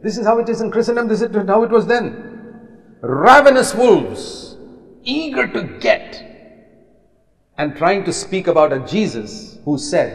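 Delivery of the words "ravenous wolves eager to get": high, slow, scornful; scornful emphasis. Real, not acted. Preacher condemning leaders as wolves, high and scornful. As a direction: spit out ravenous wolves.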